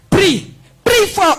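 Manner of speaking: high, loud call to pray harder. Retold or imitated. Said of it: "Et puis, il me dit".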